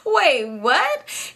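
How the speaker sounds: high, startled wait what; shocked. Retold or imitated. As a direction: jolt up in surprise. Shocked at swollen eye.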